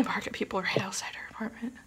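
fast hushed whisper. Real, not acted. Vlogger whispering fast about the dog barking. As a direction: whisper it quickly so the dog stays calm.

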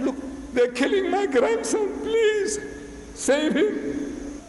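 high, pleading cry for help; frantic high-pitched begging; desperate plea. Retold or imitated. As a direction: beg to be saved, voice climbing high. Grandson pleading for rescue.

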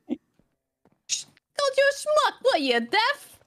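drawn-out, high-pitched comic jab; mock-indignant insult. Character insulting a rival who misheard, mock indignation. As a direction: needle them in a put-on high voice.

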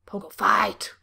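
a breathy whisper of the phrase Pogo fight; hushed whisper. Shown as a demonstration. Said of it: "repeated after plain take".

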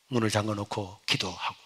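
ordinary delivery

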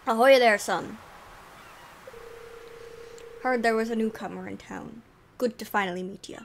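booming ahoy in a vaguely Scottish sailor voice; sea dog accent. Acted. Willy the fisherman greets the newcomer in a mock Scottish accent. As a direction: play a gruff old fisherman, half Scottish. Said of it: "I don't know what this accent is. It might be Scottish".

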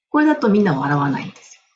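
ordinary talk

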